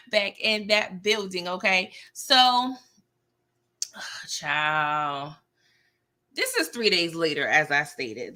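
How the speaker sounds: ordinary delivery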